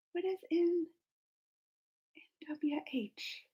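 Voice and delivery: puzzled whisper; faint, unvoiced whisper. Real, not acted. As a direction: breathe the letters to yourself. Puzzling over acronym.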